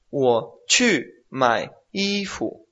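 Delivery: "wo chu mai ifu" very slow, syllable by syllable; slow Mandarin model. Shown as a demonstration. Teacher modelling a full sentence slowly for a student.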